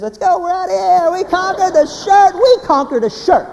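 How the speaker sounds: loud, high victory cry; triumphant whoop. Acted. Husband bragging to the audience about a quick shirt buy, triumphant. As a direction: crow it like a conquering hero. Acting out a triumphant fast shop.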